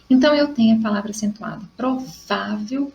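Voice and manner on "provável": "Provável" drawn out slowly, weight on VÁ; slow teaching pronunciation of "Provável", stressing VÁ. Shown as a demonstration. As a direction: stretch the middle syllable VÁ in "Provável". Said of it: "porque o vá é mais forte".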